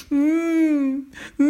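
high, sustained whimpering wail; hammed-up tearful moan; mock crying. A narrator's reading. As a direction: wail through closed lips, acted sobbing. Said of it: "il se mit à pleurer".

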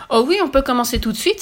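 put-on high, loud girlish enthusiasm; eager exclamation. A narrator's reading. Redhead offers to start demolishing at once, exclaiming eagerly. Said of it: "s'exclama la rouquine".

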